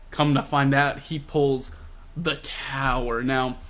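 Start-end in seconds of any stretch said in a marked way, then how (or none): none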